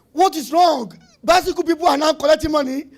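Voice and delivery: half-shouted disbelief at the news; surprised outcry. Retold or imitated. Shocked at bicycle firm banking.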